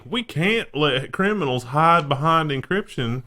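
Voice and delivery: lightly put-on accent, high and slow; a hint of a Southern judge accent; mock diminutive accent. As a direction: drawl it out like a Southern judge, slightly. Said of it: "That was supposed to be a diminutive accent".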